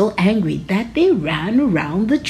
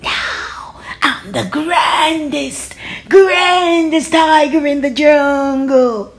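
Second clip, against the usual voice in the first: slow, higher, pompous boast; boastful tiger; 6 semitones higher, 4 dB louder, slower than usual. Acted. Tiger boasting as he struts away, slow and pompous. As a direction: drawl the boast grandly.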